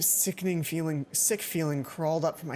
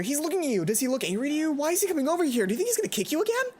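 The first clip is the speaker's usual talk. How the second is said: anxious friend; 9 semitones higher, 3 dB louder, faster than usual. Acted. Friend whispering urgent warnings.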